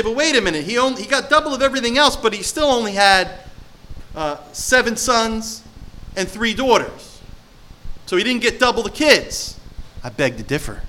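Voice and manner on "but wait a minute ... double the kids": higher, hurried objecting skeptic. Retold or imitated. Preacher voicing people who doubt Job's restoration, skeptic objecting. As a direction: argue it like a doubter who caught a flaw. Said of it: "And people say".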